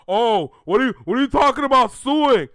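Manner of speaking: high, loud whiny fan voice; loud high mocking whine. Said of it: "here come the fans now".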